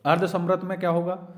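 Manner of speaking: ordinary talk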